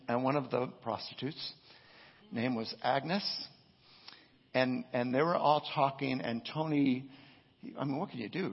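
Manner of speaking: normal talking voice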